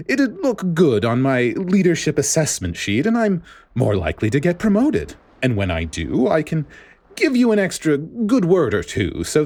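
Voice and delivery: a self-satisfied schemer pitching his offer; smug director voice. A narrator's reading.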